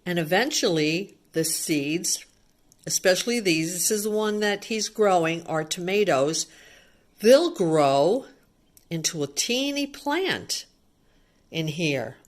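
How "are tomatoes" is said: ordinary delivery, nothing marked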